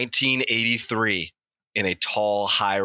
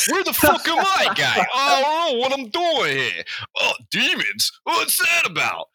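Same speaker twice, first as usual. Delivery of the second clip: high, strained voice of a lost man in hell; a high squealing businessman, baffled at waking in hell; confused Steve; 3 dB louder than usual. Acted.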